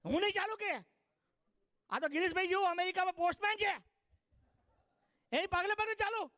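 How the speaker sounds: high, loud mock defiance; defiant bride. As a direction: refuse with cheeky scorn. Bride refuses to follow husband.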